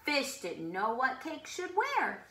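usual speaking voice

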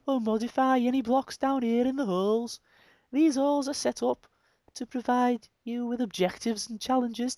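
high-pitched hybrid Brummie-Scouse reading; Brummie-Liverpudlian accent. Pretend. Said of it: "brummy Liverpudlian accent".

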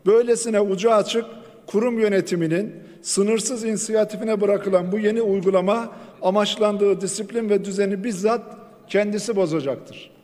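usual speaking voice

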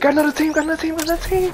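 very high, loud, fast shouting; rapid-fire warning yell; frantic alarm shout. Real, not acted. Alarm calling teammate.

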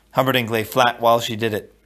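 ordinary delivery

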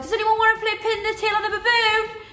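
high, hopeful call to the group; eager announcement. Retold or imitated. Party host retelling her game pitch, eagerly calling out. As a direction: pitch it up to rally a silent room. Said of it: "And I was like".

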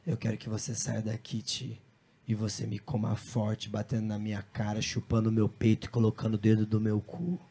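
low, very soft intimate voice; hushed, monotone pillow talk; imitated murmur. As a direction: murmur it low into someone's ear. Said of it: "a meio que cochichar, assim, falando".

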